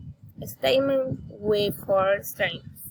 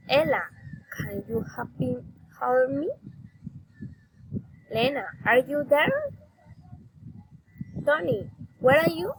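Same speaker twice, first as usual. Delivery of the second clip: questions to someone named, swung up on "you"; rising intonation; 3 semitones higher than usual. Shown as a demonstration. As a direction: call the name, then climb to "there" and "you". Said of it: "address raising intonation".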